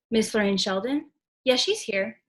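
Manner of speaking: ordinary delivery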